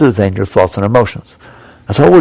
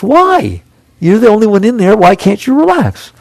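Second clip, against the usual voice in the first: incredulous why; 9 semitones higher than usual. Acted. Challenging the listener's excuse.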